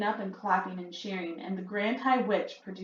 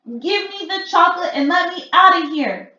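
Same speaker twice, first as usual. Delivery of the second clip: higher, loud sing-song demand; panicky shout; 11 semitones higher, 11 dB louder than usual. A narrator's reading. Bruno demanding to be let out, shouting.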